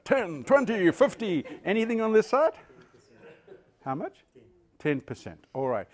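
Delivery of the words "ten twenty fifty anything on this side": loud, high auction calling; auctioneer. Pretend. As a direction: call out bids like an auctioneer. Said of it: "I feel like an auctioneer".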